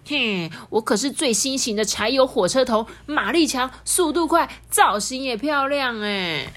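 put-on boastful young train. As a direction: show off like a cocky new locomotive. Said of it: "他很不服气说".

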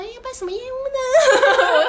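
high, slow, sweetly polite greeting; extra friendly. Retold or imitated. Clerk greeting the grumpy visitor, mock friendly. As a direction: put on a syrupy counter-clerk politeness. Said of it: "比较亲切一点啊".

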